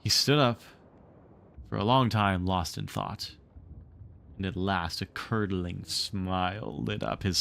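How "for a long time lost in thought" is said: ordinary delivery, nothing marked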